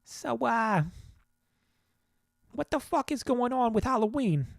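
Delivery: a bad stab at a Boston comic's rant; Bill Burr impression. Podcaster trying a comedian impression on his listeners, high and strained. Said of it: "Bill Burr, don't have it either".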